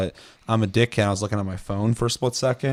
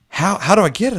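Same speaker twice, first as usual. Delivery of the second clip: put-on higher, louder naive girl voice; mock childlike eager question, pitched up; like a child; 9 semitones higher, 6 dB louder than usual. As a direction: play her as a wide-eyed kid asking. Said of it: "like a child".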